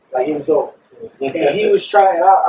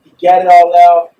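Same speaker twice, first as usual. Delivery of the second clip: ordinary delivery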